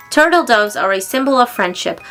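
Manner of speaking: plain reading voice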